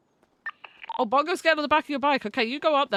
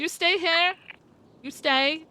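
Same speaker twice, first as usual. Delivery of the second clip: higher, firm stay command; commanding a dog; 6 semitones higher, slower than usual. Real, not acted. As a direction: tell the dog firmly to stay.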